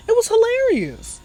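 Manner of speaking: squeaky, amused insistence; high-pitched amusement. Real, not acted. Host insists story was funny, high amused squeal. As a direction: push it way up high, tickled. Still amused after laughing fit.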